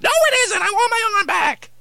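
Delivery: high loud complaint; whiny protest. Acted. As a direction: protest the baseball bat arm. Puppet protests.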